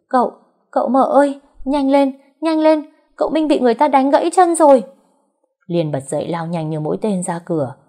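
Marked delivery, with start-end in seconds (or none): 0.1-4.8 high, loud panicked servant's cry; urgent yelling. A narrator's reading. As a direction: yell out the alarm, pitched way up. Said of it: "con sen gầm vang trời".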